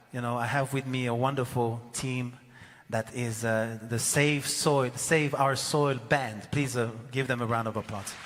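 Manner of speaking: ordinary delivery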